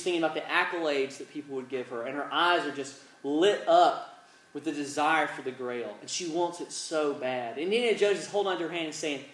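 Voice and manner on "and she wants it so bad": ordinary talk, nothing marked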